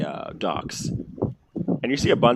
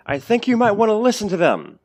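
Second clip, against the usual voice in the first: Gaius voice; 11 semitones higher, 5 dB louder than usual. Acted. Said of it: "you hear a voice say".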